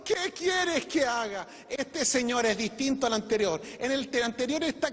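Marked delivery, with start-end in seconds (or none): 0.0-1.5 a frightened man begging his master; trembling and fearful. Acted. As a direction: Saul cries out to the Lord in fear, trembling. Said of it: "está temblando y temeroso".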